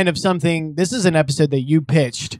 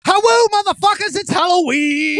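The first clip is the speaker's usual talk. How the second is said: excited; 13 semitones higher than usual. Real, not acted. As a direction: throw it out with big energy. Opening greeting, Halloween hype.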